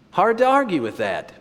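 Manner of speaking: put-on high-pitched Dutch-accented reply. Professor responds to student's answer, Dutch accent. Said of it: "in his Dutch accent".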